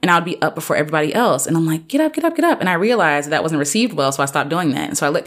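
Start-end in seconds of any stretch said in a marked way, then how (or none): 1.9-2.6 much higher, eager "get up" chant; child at a sleepover calling everyone up, eager; mock wake-up call. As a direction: rouse sleepy friends eagerly. Waking friends at sleepover.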